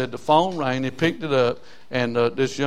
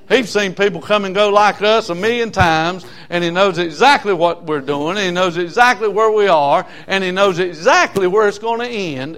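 loud, higher-pitched sermon delivery; preaching; 5 semitones higher, 7 dB louder than usual. Real, not acted. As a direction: preach it out to the congregation with force. Preaches to congregation before the story.

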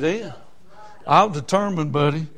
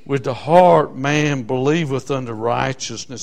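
scripture reading; 6 semitones lower than usual. Acted. Reads the verse.

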